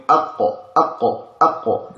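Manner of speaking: aqqa with a stopped, airless q; qaf demonstration. Shown as a demonstration. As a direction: say aqqa cutting the air off on the q. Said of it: "The voice is audible. The air is not flowing".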